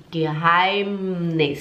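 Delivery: Geheimnis drawn out, humming on the M; closed, held M. Shown as a demonstration. Teacher models Geheimnis for learners, slow with held M. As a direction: say Geheimnis slowly, lips closing on a long M. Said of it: "você tem que fechar".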